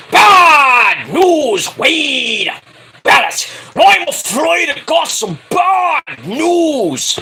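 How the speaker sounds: booming, drawn-out announcer proclamation; Bad News Barrett, N=Bad News Barrett/character. Host parodying the commentator announcing bad news, booming impression. Mimicking a wrestling commentator's bombast.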